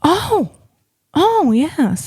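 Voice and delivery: hammed-up, high swooping oohs; mock awe. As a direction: swoop up high as if hugely impressed. Skit, mock awe at Porsche rider.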